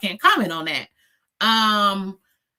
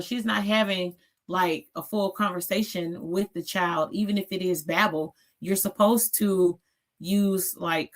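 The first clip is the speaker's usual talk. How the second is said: ordinary delivery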